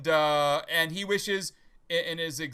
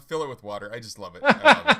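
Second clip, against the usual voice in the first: laughing; 4 semitones lower, 7 dB louder than usual. Real, not acted. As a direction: laugh the line out. Quoting punchline while laughing.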